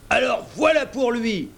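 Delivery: high, violent outburst; angry pirate cry; oath-like threat. A narrator's reading. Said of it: "en proférant un juron".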